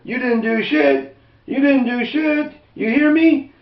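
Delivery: high, slow sneering cop taunt; mocking deputies. Deputy sneering at the dying man, high mocking taunt. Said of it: "These are the deputies speaking back to him".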